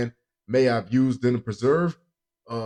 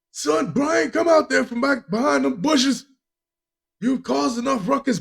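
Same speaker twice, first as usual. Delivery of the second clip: high-pitched Long Island parent calling out; put-on Long Island accent; 13 semitones higher than usual. Father calling son out of the bushes, mock Long Island accent. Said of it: "that's the accent for a Long Island family".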